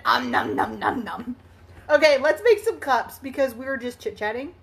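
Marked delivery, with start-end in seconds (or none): 0.0-1.4 Cookie Monster munching. Retold or imitated. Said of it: "Cookie Monster".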